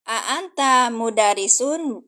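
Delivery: "A anta mudarisun" drawn out slowly, each word clear; Arabic question read slowly. Shown as a demonstration. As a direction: say "A anta mudarisun" like a teacher modelling. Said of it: "apakah kamu seorang guru?". (mengucapkan "A anta mudarisun" pelan dan jelas)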